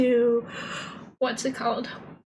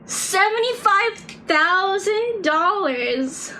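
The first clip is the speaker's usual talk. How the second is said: slow, higher incredulous repeat of "Seventy-five thousand dollars"; stunned disbelief; 7 semitones higher, slower than usual. Real, not acted. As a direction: stretch it out, can't believe it.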